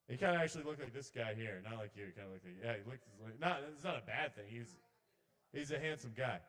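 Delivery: usual speaking voice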